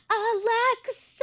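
high, drawn-out call to the device; calling Alexa. Pretend. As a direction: sing out the name to wake the speaker. Trying to wake everyone's Alexas.

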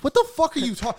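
retold furious yell. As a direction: fire it back in outraged disbelief. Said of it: "And I was like".

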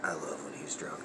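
whispered aside. Retold or imitated. Telling Brick about drunk Nikki, in a whisper. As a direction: whisper it to a friend like a secret. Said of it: "look at Brick and be like".

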